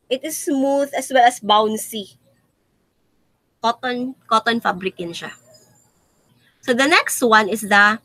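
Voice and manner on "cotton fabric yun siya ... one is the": ordinary talk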